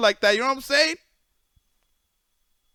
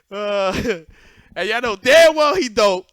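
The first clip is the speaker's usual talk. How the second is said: laughing; 4 semitones higher, 5 dB louder, slower than usual. Real, not acted.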